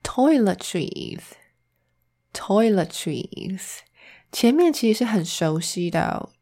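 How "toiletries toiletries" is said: Toiletries said slowly, syllable by syllable, rising at the end; slow pronunciation demo. Shown as a demonstration. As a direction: carefully sound out Toiletries. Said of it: "英文怎么说呢".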